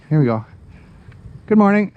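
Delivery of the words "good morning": high, bright call to a passerby; cheery greeting. Real, not acted. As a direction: sing out a friendly hello. Greeting a passing walker.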